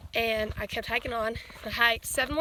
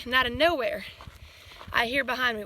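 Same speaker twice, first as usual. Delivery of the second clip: ordinary talk, nothing marked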